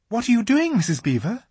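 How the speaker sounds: put-on high girl's voice; puzzled outcry; surprised Susan; Susan exclaims. A narrator's reading. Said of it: "exclaimed Susan".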